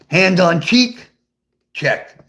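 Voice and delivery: ordinary delivery, nothing marked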